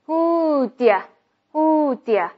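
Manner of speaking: slow, stretched "Who" then "dia"; weak do you. Shown as a demonstration. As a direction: draw out "Who" and link it to "dia". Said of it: "所以读成dia". (慢速示范 Who dia 连读)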